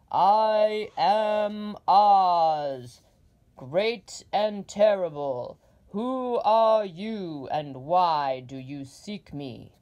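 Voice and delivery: very loud, very slow, echoing giant head; booming Oz. Acted. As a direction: boom each word down from the throne. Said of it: "not such an awful voice".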